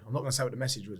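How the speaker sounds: ordinary talk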